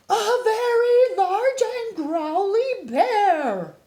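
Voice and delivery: high, loud and slow, drawn out in alarm; frightened reveal. Acted. The bear staring at the scary bear in the river, slow alarmed cry. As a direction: stretch it out in wide-eyed fright.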